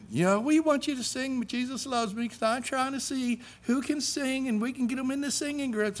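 a hint of a gushing teacher; music teacher voice. Teacher greeting new student, lightly voiced high and soft.

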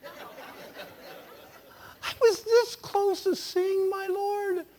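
very high, loud, slow wail; exasperated outcry. Acted. Man who missed seeing his Lord, wailing loudly. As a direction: cry it out high and drawn out in mock frustration. Comic frustration at surviving his heart attack.

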